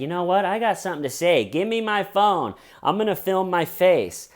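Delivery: high, loud celebrity impulse; self-important Hollywood star. Retold or imitated. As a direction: announce it like an entitled star. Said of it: "the Hollywood elite decide".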